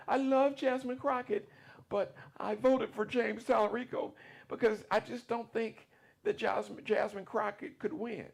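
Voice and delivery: high, tearful mimic of an older woman; mock her tearful plea, high and wavering; imitating tearful woman. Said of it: "She said".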